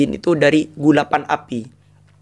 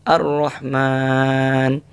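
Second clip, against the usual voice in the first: gentle recitation; much slower than usual. Shown as a demonstration. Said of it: "Kalau Ar-Rahman lembut kayaknya".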